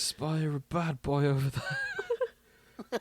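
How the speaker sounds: slow, drawn-out pirate call through a laugh; hammed-up pirate lookout. Jokingly playing a pirate lookout for friends, slow laughing call. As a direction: play the lookout spotting a ship, slow and laughing.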